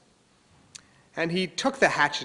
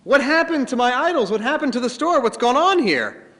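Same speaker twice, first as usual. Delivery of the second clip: acted father shouting, higher and louder; outraged dad, pitched up and loud; angry father; 9 semitones higher, 7 dB louder than usual. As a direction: demand answers from the boy, voice raised high. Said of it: "and he says".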